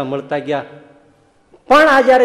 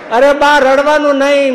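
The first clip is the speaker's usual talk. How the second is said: son loudly stops her crying, much higher; louder, higher call; 11 semitones higher, 11 dB louder than usual. Retold or imitated. Son tells weeping mother not to cry: louder, higher plea. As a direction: raise your voice to calm her.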